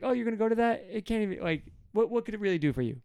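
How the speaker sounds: high-pitched mocking question; humorous incredulity. God mocking Israel's choice of idols, humorous incredulity. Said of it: "kinda, kinda humorous".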